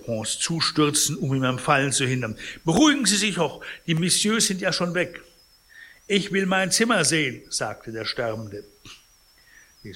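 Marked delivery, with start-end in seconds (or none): 2.6-5.2 high, loud attempt to calm; urgent housekeeper. A narrator's reading. Housekeeper steadies the agitated sick man, high urgent voice. 6.1-7.4 raised, louder demand; insistent sick man. A narrator's reading. Dying man demands to see his room, higher insistent voice.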